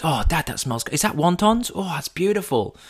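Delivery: a small cub sniffing dinner; baby fox. Acted. Baby fox smelling crispy duck, soft and high. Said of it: "Little baby fox".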